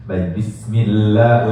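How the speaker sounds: long la in bismillah, two counts; bismillah held two beats. Shown as a demonstration. Teacher to class, stretching la for two beats. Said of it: "la dua harokat".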